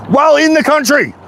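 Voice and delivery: high-pitched angry disbelief; indignant outburst. Real, not acted. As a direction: voice jumps up in outrage. Indignant rant about separatists.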